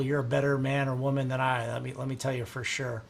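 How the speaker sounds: ordinary talk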